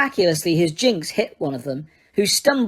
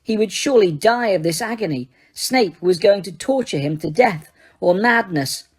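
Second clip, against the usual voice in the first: ordinary delivery, nothing marked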